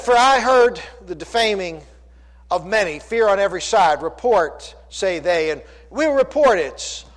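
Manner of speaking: loud, emphatic pulpit reading; declaimed scripture reading. Acted. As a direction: proclaim the verse to the congregation.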